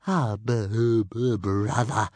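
stammering, slow dragon groan; weary little dragon's stammered moan. A narrator's reading. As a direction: moan it out, tripping on the first sound. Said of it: "moaned Toothless".

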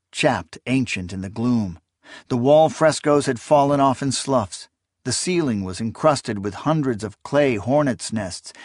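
ordinary delivery

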